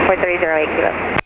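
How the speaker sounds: usual speaking voice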